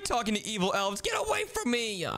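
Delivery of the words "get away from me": scared yelp. Acted. Fleeing the evil elf.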